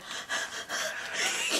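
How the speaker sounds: voiceless, soft mock panting; exhausted panting. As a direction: pant as if worn out from hiking. Imitates panting after sweating on hike.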